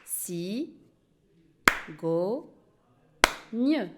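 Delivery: "Si, go, gne" as three separate beats, long pause after each; syllable by syllable. Shown as a demonstration. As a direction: split "Si, go, gne" into clapped-out syllables. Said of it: "découper le mot en syllabes". (« Si, go, gne » détaché syllabe par syllabe)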